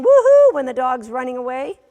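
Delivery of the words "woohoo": high, loud, gushing cheer; imitating an overexcited owner whooping; excited woohoo. Trainer mimicking Barbara cheering the runaway dog, excited whoop. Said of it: "just like with Barbara saying".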